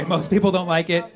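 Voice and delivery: ordinary talk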